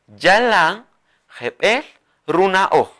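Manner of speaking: Yalan, jebel, runa oh said apart with pauses; word by word dictation. Shown as a demonstration. Teacher splits the sentence for students to copy. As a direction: break the phrase into pieces. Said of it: "Así".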